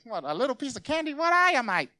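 put-on high, fast sassy kid voice; mock kid retort, rising to loud; cheeky kid. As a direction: scoff like an unimpressed child. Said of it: "They even talk like that".

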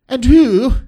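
high, loud, haughty BBC English; BBC accent. Acted. Proud law teacher questioning Jesus, exaggerated BBC accent. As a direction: put on a prideful BBC accent. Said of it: "my B B C accent".

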